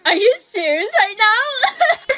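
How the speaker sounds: loud, high, drawn-out disbelief; outraged. Acted. Girl thrown off the cheer squad, outraged. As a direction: protest it loudly, stretching the words.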